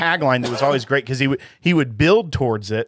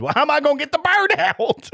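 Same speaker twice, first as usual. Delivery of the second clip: very high, put-on old lady voice; mock-exasperated high wail; mad elderly lady; 14 semitones higher than usual. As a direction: imitate an upset elderly caller, pitched way up. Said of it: "the lady that was, uh, really mad".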